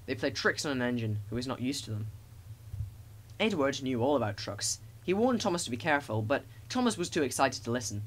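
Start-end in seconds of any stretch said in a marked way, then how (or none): none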